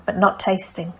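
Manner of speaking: ordinary delivery, nothing marked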